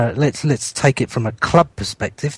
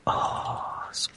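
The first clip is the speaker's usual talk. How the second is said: whispered oh; 15 dB quieter than usual. Real, not acted. Annoyed at the pin.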